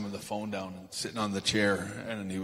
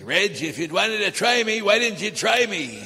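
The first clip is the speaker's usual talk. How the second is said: loud, high, furious yell down the phone; heated shout; 11 semitones higher, 11 dB louder than usual. Retold or imitated. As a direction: shout it like a man who's been provoked. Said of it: "my dad's starting to get heated on the phone".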